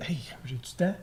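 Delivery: murmure pour soi, comme une révélation; chuchoté. Retold or imitated. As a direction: se le dire tout bas. Réalisation intérieure chuchotée. (murmure intime)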